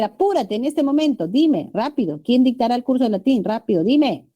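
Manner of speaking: teacher acts out an impatient order to students, urgent; urgent command. Shown as a demonstration. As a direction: demand it right now, impatient. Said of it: "yo le puedo decir".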